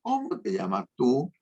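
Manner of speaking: "¿Cómo te llamas tú?" pitched high as a clear question; question intonation. Shown as a demonstration. Teacher showing how questions sound, high question intonation. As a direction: lift "¿Cómo te llamas tú?" well above normal speech. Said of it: "¿Dónde se nota más eso? En las preguntas".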